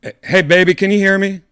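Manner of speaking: loud, high call to get the assistant's attention. Real, not acted. Streamer calling loudly to his AI, high and loud. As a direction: shout into the phone to be heard.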